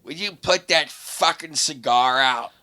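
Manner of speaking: mock her demand, stretching each word; mean garbage voice. Angry lady turning round about the cigar, mean garbage voice. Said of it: "in the meanest, like, garbage voice".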